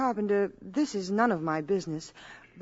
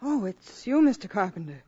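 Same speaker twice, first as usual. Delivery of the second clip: a startled gasp of a greeting, higher and louder; 4 semitones higher, 4 dB louder than usual. Acted. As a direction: caught off guard, then recognising him. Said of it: "I'm afraid I startled you".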